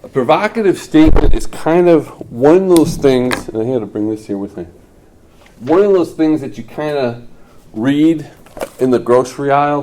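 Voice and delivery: booming classroom voice; loud lecture voice. Real, not acted. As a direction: boom it out to the class. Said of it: "teacher projecting to class".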